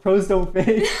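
amused low-key replay of a holler; retold yell, amused. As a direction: retell the shout without shouting, laughing. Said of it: "I, I yelled".

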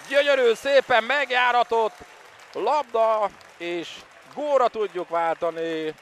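loud, high goal call; excited commentary. Real, not acted. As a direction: shout the goal with joy. Home goal in water polo.

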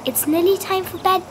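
high, gentle motherly reply; lightly sing-song mum; mother's voice. A narrator's reading. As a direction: tell a child it's bedtime.

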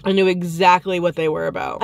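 usual speaking voice

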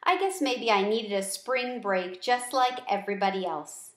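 plain reading voice

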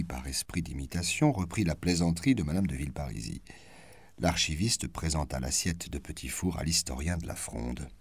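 plain reading voice

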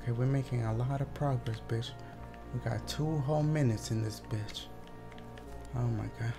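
ordinary talk, nothing marked

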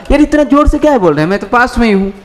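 mock complaint. Acts complaint about loud talking.

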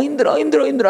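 high strained "힘들어" held back; pained pretend sulk; mock suppressed grief. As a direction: whine it while holding in tears. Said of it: "꾹꾹 참으면서 힘들어 죽겠는데".

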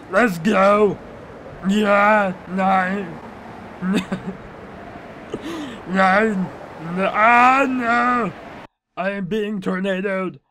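mock-panicked action hero with drawn-out vowels; Arnold Schwarzenegger. Farmer caught in the tornado, hammed-up Austrian accent. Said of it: "Am I still Arnold Schwarzenegger".

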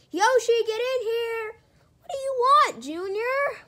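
loud shout across the room; loud call from the next room. Acted. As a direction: yell the name to call him in. Puppet characters yelling across room.

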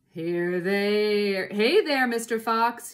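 loud taunting call; yelling. A narrator's reading. Bunce taunting Mister Fox, loud yell. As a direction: holler it down to the fox. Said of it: "yelled Bunce".

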